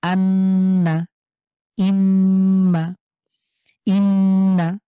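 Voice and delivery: আন্না and ইম্মা with the ন and ম hummed long through the nose, loud and very slow; ghunna. Shown as a demonstration. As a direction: hold the nasal hum on ইন্না, drawn out. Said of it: "এই দুটোতে আমরা গুণনা করে পড়বো".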